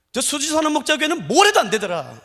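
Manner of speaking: 뭘 punched loud, with a falling end. Shown as a demonstration. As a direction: say 뭘 해도 안 되더라 like a scornful outsider. Same words quoted again, stronger.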